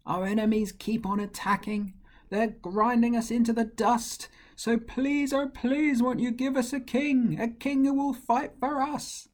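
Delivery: high pleading crowd voice; people begging for a king. Acted. The people beg God for a king, high and pleading. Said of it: "But the people said".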